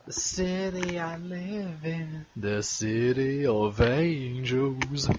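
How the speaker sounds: slow, soft, cool Los Angeles drawl; cool LA accent. Pretend. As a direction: lay it on like a movie star. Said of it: "The cool accent".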